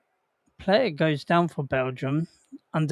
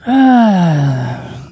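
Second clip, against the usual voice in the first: loud sigh; 12 dB louder than usual. Real, not acted. Late game sigh.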